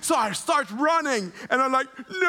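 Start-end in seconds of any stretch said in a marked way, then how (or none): 2.1-2.3 long slow-motion "no" of horror; acted freak-out. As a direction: stretch out a mock panicked cry. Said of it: "So I begin to freak out".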